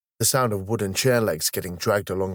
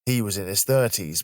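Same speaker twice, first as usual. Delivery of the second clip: ordinary delivery, nothing marked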